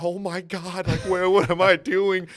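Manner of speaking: a freefall yelp of terror; panicked yell. Retold or imitated. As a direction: shout it like you're falling through the sky.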